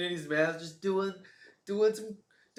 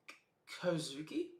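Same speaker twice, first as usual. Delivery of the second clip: breathless whisper of Kozuki, rising; hushed stunned whisper; whispered disbelief; 7 dB quieter than usual. Acted. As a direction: whisper the name as if unsure it's true. Character gasps name in disbelief.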